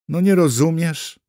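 exasperated interpreter. A narrator's reading. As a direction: press him to understand, almost singing. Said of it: "zanuciła znowu". (zniecierpliwione, podniesione „nie rozumiesz”)

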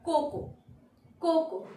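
"cocoa" as KO-ko; silent a. Shown as a demonstration. Teacher giving the right word, "cocoa" as KO-ko. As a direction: say "cocoa" in two syllables, final a unsounded. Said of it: "The word is".

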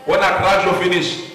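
normal talking voice

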